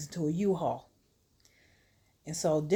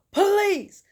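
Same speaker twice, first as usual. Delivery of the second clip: very high, loud begging; escalated, exasperated mock shout; desperate yelled plea; 12 semitones higher, 7 dB louder than usual. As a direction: cry out at the end of patience. Kendrick pleads again, more forcefully.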